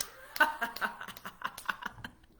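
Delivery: imitated airy fake laugh; a knowing put-on chuckle. Son's sly fake laugh after the lockout, imitated. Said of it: "his fake laugh".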